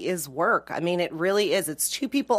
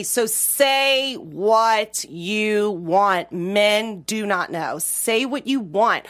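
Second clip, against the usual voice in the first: very slow, spelled-out insistence; slow emphatic advice; much slower than usual. Real, not acted. Comedian telling women to just say what they want, very slow and emphatic. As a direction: lay it down word by word.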